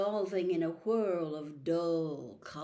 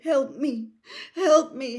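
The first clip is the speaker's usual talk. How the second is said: desperate cry; 4 semitones higher, 8 dB louder than usual. A narrator's reading. Harry begging in mind.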